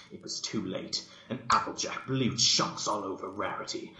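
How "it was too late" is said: ordinary delivery, nothing marked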